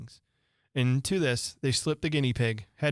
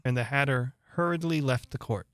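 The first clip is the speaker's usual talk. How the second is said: ordinary delivery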